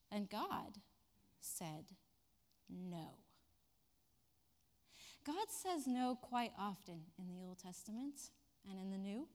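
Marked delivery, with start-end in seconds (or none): 0.1-3.2 slow, quiet, pause before no; dramatic pause. Real, not acted. Preacher delivering God's answer, hushed. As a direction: let the no land.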